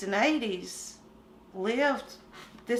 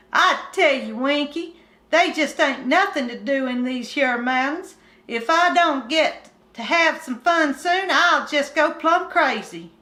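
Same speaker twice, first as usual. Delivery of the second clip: put-on higher, louder hillbilly boy complaining; bored country boy; 5 semitones higher, 4 dB louder than usual. Kim complaining to Winky about boredom, higher country boy voice. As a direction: whine it like a restless mountain kid. Kim's dialogue in story.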